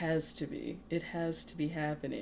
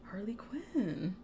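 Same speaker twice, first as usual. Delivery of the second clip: whispered answer; 6 semitones higher, 5 dB quieter than usual. Real, not acted.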